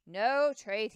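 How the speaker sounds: loud, flat refusal; firm refusal. A narrator's reading. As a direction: shut the deal down hard. Lewis refuses trade firmly.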